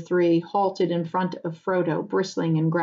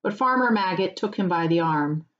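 ordinary delivery, nothing marked

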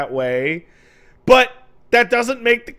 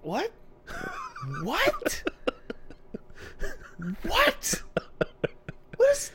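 rising, much higher squeaky questions; climbing disbelief with each What; incredulous rising What; baffled; 10 dB quieter, much slower than usual. Real, not acted. Baffled by the titties joke.

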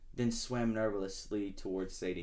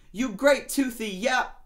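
high, louder cheeky lobster jeer; gruff lobster; 11 semitones higher, 5 dB louder than usual. Acted. Lobster mocking Shark, high and loud.

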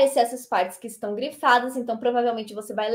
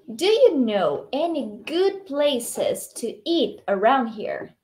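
slow, measured language-teacher model; model dialogue reading; much slower than usual. Shown as a demonstration. Teacher reading Emma's answer for students to repeat, slow model reading. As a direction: give each phrase slowly, with clear intonation. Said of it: "tenta imitar a minha entonação".

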